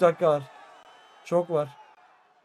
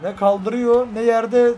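frustrated outburst; 4 semitones higher, 6 dB louder, much slower than usual. Real, not acted. Frustrated at opponent.

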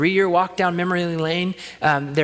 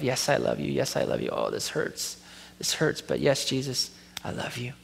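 pained confession; 3 semitones lower, 8 dB quieter than usual. Retold or imitated. Peter answering Jesus, pained.

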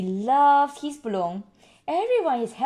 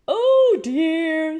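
Daddy Pig; 6 semitones higher, 8 dB louder than usual. Acted. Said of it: "says Daddy Pig".

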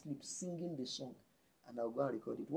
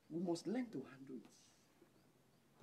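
half as fast, much softer, drawn out; slow soft repeat; 2 semitones lower, 6 dB quieter, much slower than usual. Shown as a demonstration. Preacher repeating the point softly to the church, slow and hushed. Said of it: "But we must learn to handle it".

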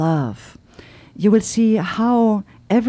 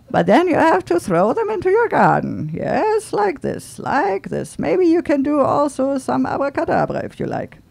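playful salesman voice; 7 semitones higher than usual. Retold or imitated. Simon pitching seeds to tourists.

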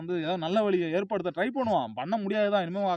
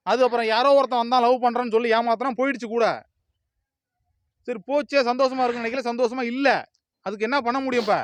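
loud, high, furious telling-off; a shouted rebuke pitched up high; angry scolding; 6 semitones higher, 8 dB louder than usual. Acted. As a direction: yell the blame right at him. Scolding a crying relative.

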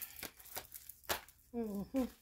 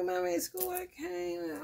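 son's plea; 7 semitones higher, slower than usual. Retold or imitated. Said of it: "If my son tell me".

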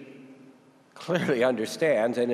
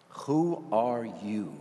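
slow probing question; much slower than usual. Shown as a demonstration. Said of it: "not the sort of question that you ask people".

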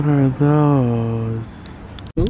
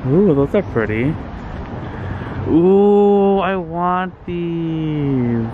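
excited; 5 semitones higher, much slower than usual. Real, not acted. Delighted over plants.

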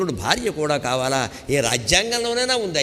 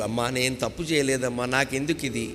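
soft plea; 4 semitones lower, 6 dB quieter than usual. Acted. Voicing a devotee's plea.

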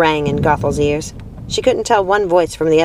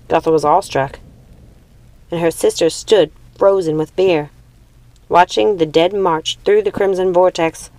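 ordinary delivery

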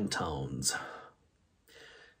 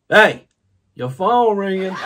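loud, raised shout; ringtone callout; 7 semitones higher, 10 dB louder than usual. Retold or imitated. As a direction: call it out much louder and higher. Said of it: "and it goes".

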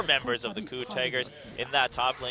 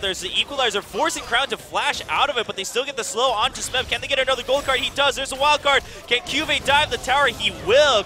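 excited esports casting; play-by-play; 13 semitones higher, 9 dB louder than usual. Real, not acted. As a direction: hype up the teamfight call. Live play-by-play hype.

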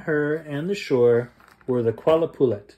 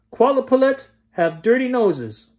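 high, loud taunting yell; 9 semitones higher, 5 dB louder than usual. Acted. Girl yells a taunt across the ice, loud and high. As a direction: yell it out over the sea ice. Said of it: "and yelled".

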